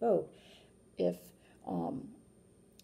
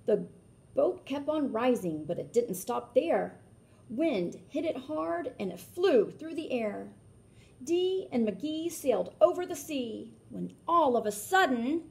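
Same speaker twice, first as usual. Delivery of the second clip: lilting read-aloud rhyme; storybook narration; 4 semitones higher, 8 dB louder, slower than usual. A narrator's reading. Reading a rhyming book aloud to children, lilting. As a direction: read it like a picture book for kids.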